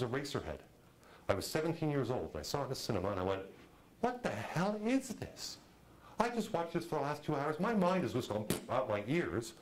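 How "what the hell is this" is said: high-pitched, drawn-out bewilderment; baffled disbelief. Retold or imitated. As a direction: stretch it out, high and incredulous. Recalls baffled reaction to film.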